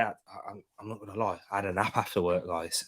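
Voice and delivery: sleepy, low-energy mumble; worn out after a long day; tired. Real, not acted. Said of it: "I was shattered".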